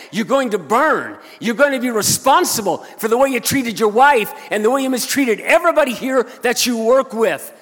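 high, full-force condemning shout; thundering rebuke. Retold or imitated. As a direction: let loose like a hellfire preacher. Confronts coworker with his damnation.

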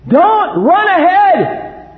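high, loud, slow warning shout; slow, drawn-out parent's shout down the slope; commanding yell. Retold or imitated. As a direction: call it out sharply to the running kids. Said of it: "I just commanded them".

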